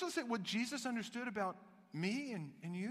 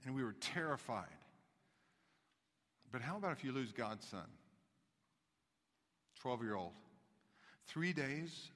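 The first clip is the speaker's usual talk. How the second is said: low, hushed preacher's wonder; soft, awed sermon voice; hushed awe; 8 semitones lower, 9 dB quieter than usual. Acted. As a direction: draw them in with awe.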